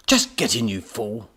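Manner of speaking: loud, impatient command that eases off; barked order. A narrator's reading. Professor hurrying clumsy robot, barked order. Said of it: "barked the professor".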